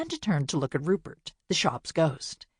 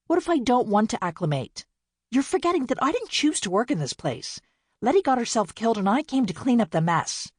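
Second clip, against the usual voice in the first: angry retort; 6 semitones higher than usual. A narrator's reading. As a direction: lash out at her sisters. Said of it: "Amanda flinched at her sister's tone".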